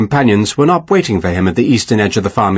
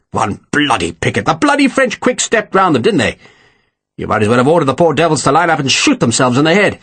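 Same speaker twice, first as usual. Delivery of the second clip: higher-pitched exasperated tirade; exploding in anger; 4 semitones higher than usual. A narrator's reading. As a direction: berate an officer without really shouting. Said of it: "Picton exploded".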